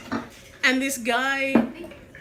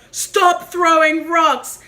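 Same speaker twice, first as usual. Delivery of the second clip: slow, raised scolding yell; acted shouted order; 3 semitones higher, 5 dB louder, much slower than usual. Chef riding over to kids chucking rocks, slow shouted order. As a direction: tell off kids, loud and drawn out. Man confronting rock-throwing kids, retold.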